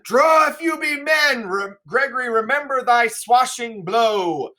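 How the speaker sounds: rally them to fight; shouted challenge, slowing at the end; battle cry. Acted. Samson calling for swords.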